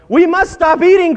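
high, loud storybook wail; hammed-up, high-pitched outburst; desperate cry. A narrator's reading. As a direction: cry out in mock alarm. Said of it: "cried Toad as he ate another".

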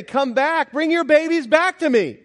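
high, loud call to come back. Retold or imitated. Jesus calling the shooed parents back, high and loud. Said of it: "the Lord is actually calling for the parents".

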